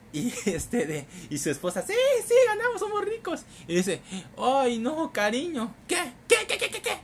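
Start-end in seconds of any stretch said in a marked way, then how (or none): 1.8-3.4 high, shrill ecstatic wife; wife shrieking with joy over the win; excited wife. Acted. Wife voiced winning lottery. 4.4-7.0 excited wife. Acted. Husband and wife exchange.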